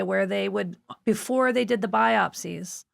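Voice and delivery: usual speaking voice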